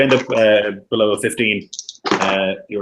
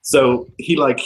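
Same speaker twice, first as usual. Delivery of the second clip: ordinary delivery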